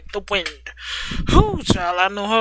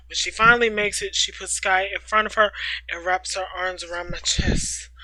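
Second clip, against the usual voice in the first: usual speaking voice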